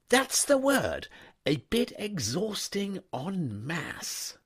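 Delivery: put-on an easygoing young master stopping his valet; young toff. A narrator's reading. Wooster seizes Jeeves's word.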